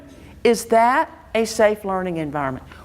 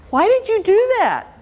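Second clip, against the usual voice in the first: exasperated demand; 12 semitones higher than usual. Retold or imitated. Teacher confronting little Johnny about the mess, exasperated. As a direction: fire the question at the kid. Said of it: "and we say".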